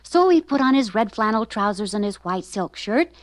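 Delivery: ordinary delivery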